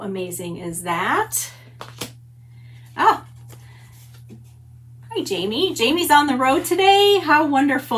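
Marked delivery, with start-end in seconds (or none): 2.9-8.0 bright, much higher and louder hello to a friend; light up as a friend joins the stream; real delighted welcome, higher and louder; excited greeting. Real, not acted. Greeting a viewer on the road.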